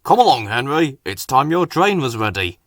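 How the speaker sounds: firm and loud, a lightly voiced boss; firmly. A narrator's reading. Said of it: "said the Fat Controller firmly".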